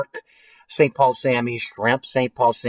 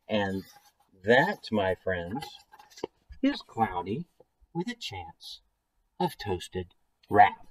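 dramatic story ending; 5 semitones lower, 9 dB quieter, slower than usual. A narrator's reading. Closing line of the book.

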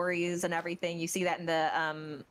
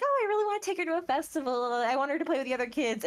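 higher, fast and eager; Yoimiya's voice; 7 semitones higher, faster than usual. Retold or imitated. Yoimiya planning to take Sayu to a festival, fast and high. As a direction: gush about the festival in a rush. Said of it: "So Yoimiya's like".

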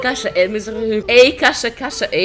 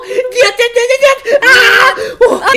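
high frantic screaming; panicked scream; 12 semitones higher, 5 dB louder, much slower than usual. Real, not acted. Dying in the game.

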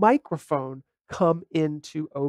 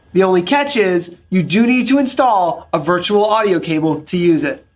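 upbeat louder intro read; promo voiceover; 5 dB louder than usual. Acted. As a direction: sell it like a channel promo. Intro voiceover over music.

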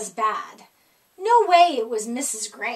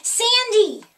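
high, loud shout; yelled; 8 semitones higher, 6 dB louder than usual. A narrator's reading. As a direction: call it out together. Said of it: "everyone yelled".

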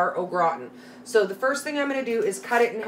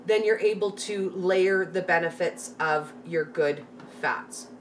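usual speaking voice

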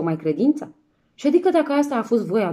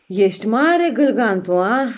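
loud father exclamation; 2 semitones higher, 7 dB louder, slower than usual. Acted. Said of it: "Și zise".

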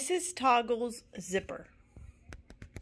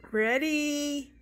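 very slow, loud, raised call; rally them with a slow shout; teacher shouts the signal to pull; yelled; 6 semitones higher, 7 dB louder, much slower than usual. A narrator's reading. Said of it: "yelled Missus Toggle".

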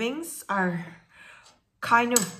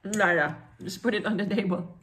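talking around a bite; eating while speaking; mouth full, chewing; 2 semitones lower than usual. Real, not acted. Seen 2: Bit food at -3s, keeps eating, raises more during words.

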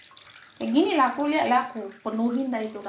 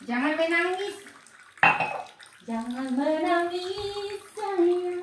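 higher, slow, coaxing voice; soothing the cat; 6 semitones higher, much slower than usual. Real, not acted. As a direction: coo it gently to calm a crying pet. (menenangkan kucing yang menangis dengan suara tinggi dan lembut)

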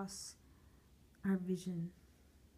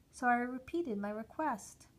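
ordinary delivery

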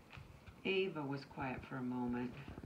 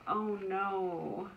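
higher, louder cry of dismay; a storybook wail of oh no; 6 semitones higher, 7 dB louder than usual. A narrator's reading. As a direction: cry it out like a let-down child. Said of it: "Ava cried".

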